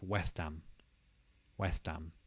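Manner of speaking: West Ham said west-am, joined. Shown as a demonstration. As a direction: run West into am. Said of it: "So there's no H on ham".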